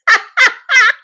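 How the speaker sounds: high, loud burst of laughter. Real, not acted. Livestream host cracking up after [name] remark, loud high laughter.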